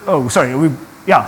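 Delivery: a quiet laugh over a slip; embarrassed laugh. Real, not acted. Preacher to congregation after a wrong slide, laughing quietly. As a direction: laugh it off softly, caught out.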